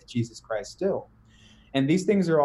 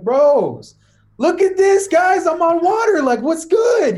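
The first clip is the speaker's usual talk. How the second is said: much higher and louder, whooping in disbelief; excited shout; 11 dB louder, slower than usual. Retold or imitated. Peter calls to the guys in the boat, excited high shout. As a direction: yell to your buddies like you can't believe it. Peter amazed walking on water.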